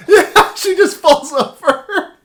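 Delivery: laughing through the words. Real, not acted. Laughs at the story.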